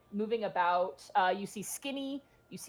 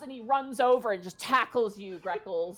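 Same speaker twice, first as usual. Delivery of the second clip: ordinary talk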